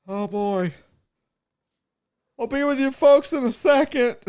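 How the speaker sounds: high weary mock voice; overwhelmed clerk. Imitating the stranded clerk facing the crowd, weary. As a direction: sigh it out as the left-alone clerk. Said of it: "She was just like".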